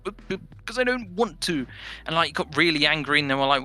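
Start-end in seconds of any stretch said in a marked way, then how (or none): 0.0-1.6 stammering. Retold or imitated. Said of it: "He's like".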